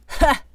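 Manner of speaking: sudden high, loud barking laugh; one coughing burst of laughter; coughing guffaw. Acted. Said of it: "let out a coughing guffaw".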